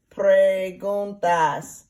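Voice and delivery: Preguntas drawn out very slowly, every syllable clear; slow modelled Spanish. Shown as a demonstration. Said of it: "And I'll spell that".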